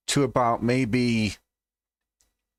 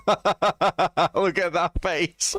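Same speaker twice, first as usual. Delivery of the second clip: laughing; 5 semitones higher, much slower than usual. Real, not acted. Laughing at a video.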